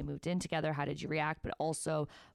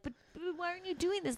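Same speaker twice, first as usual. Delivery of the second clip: put-on much higher and fast, like a child; whiny kid voice; 12 semitones higher, 3 dB quieter, much faster than usual. Imitating a child asking a parent, higher and fast. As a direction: pester like a little kid.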